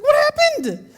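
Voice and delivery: high, loud bewildered laugh; baffled, laughing at the mystery; stunned. Real, not acted. Stunned at the vanished fire.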